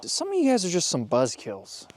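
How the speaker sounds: ordinary talk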